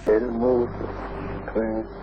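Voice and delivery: ordinary talk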